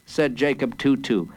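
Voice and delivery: plain reading voice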